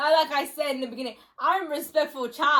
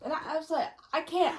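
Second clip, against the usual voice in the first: usual speaking voice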